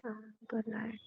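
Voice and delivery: very soft, breath-only reply; barely audible bedtime murmur; whispered goodnight. Real, not acted.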